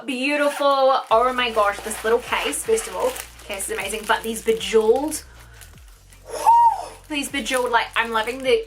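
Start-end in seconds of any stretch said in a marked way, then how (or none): 6.3-6.9 high squeal. Real, not acted. Reacting to bejeweled brushes.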